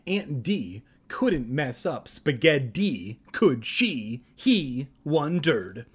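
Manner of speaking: plain reading voice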